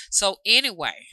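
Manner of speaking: normal talking voice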